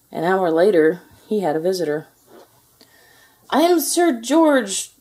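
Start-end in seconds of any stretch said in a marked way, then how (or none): 3.5-4.9 high, loud, slow announcement; grand introduction in a squeaky voice; squeaky knight. A narrator's reading. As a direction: squeak it out as a thin little knight. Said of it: "squeaked a thin, shiny knight".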